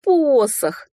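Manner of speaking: high, drawn-out raccoon plea; desperate little animal begging; pleading. A narrator's reading. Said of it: "взмолился енот".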